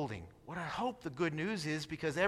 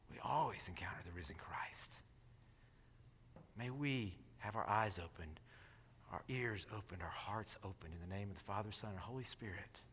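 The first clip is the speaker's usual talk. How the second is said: much softer and lower, reverent blessing; closing prayer; 9 semitones lower, 15 dB quieter than usual. Real, not acted. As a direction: close the sermon with a hushed prayer.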